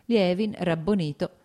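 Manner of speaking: usual speaking voice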